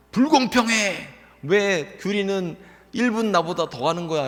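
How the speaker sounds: put-on indignant child's complaint. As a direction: play the outraged big sister. Daughter complains on phone.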